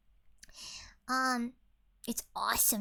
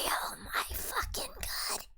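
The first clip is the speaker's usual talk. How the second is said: whispered cry; 14 semitones higher, slower than usual. Real, not acted. Overwhelmed longing for England.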